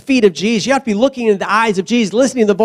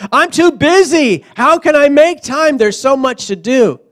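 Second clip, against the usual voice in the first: whiny busy-person voice, raised and drawn out; mocking a fool; 7 semitones higher, 4 dB louder than usual. Preacher mocking the too-busy believer, whiny. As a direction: imitate a frantic excuse-maker. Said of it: "That's a fool speaking".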